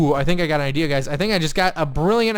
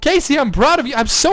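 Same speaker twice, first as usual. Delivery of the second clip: high, loud burst of real pride; overjoyed, proud; 9 semitones higher, 6 dB louder than usual. Real, not acted. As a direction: gush like a proud parent. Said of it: "overjoyed and so proud".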